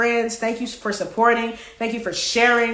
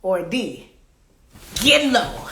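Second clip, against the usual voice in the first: doing Mystical; slower than usual. Retold or imitated. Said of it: "That was my best mystical".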